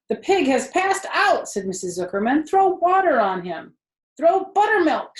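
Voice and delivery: high loud urgent cries; alarmed cry. A narrator's reading. Adults shouting as Wilbur faints. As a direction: call out in a panic.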